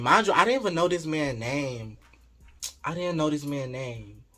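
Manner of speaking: normal talking voice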